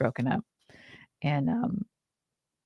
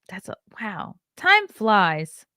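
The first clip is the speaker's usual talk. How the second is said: ordinary talk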